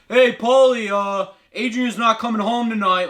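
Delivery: calling out. Retold or imitated. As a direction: holler it out of a window. Calls out of the window.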